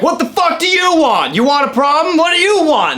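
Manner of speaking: imitating angry biker. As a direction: play the raging drunk biker going off at them. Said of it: "going off at all".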